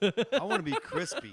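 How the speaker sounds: loud, high, playful boast; excited outburst. Real, not acted. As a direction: belt it out, proud and silly. Jokey boast among friends.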